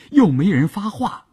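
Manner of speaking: ordinary delivery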